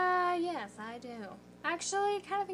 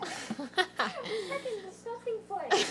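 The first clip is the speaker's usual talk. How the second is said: laughing; 6 semitones higher, much slower than usual. Real, not acted. Laughing at a disgusting foot.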